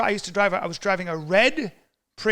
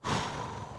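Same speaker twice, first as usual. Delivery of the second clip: soft breathy exhale; sigh; 11 dB quieter than usual. Pretend. Hero readying himself, soft exhale. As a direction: let out a slow, soft breath. Hero fantasy before the fight.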